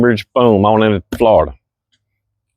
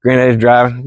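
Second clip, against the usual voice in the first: ordinary talk, nothing marked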